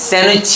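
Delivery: "স্যান্ডউইচ" as "san-wich", D left out; silent D. Shown as a demonstration. Teacher repeating the correct sandwich for students, silent D. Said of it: "not স্যান্ডউইচ".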